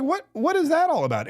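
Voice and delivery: a light hammed-up what-just-happened; baffled. Host reacting to the thirty-second vote, mock baffled and high.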